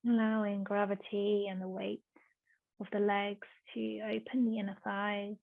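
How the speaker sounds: soft, hushed yoga instruction with long pauses; unhurried, softly spoken relaxation guidance; guided meditation. Real, not acted.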